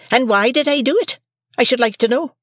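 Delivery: shrill high shrewish scold; scolding sister. A narrator's reading. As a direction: Mrs Joe scolds young Pip, high and shrill. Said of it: "exclaimed my sister".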